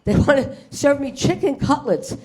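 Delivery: ordinary talk, nothing marked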